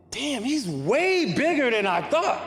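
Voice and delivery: high, slow, amazed; awestruck. Retold or imitated. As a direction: let the awe stretch out slowly. Awestruck at seeing Jordan.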